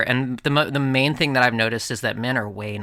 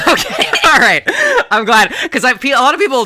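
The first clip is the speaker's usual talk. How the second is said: relieved laugh; 10 semitones higher, 7 dB louder than usual. Real, not acted.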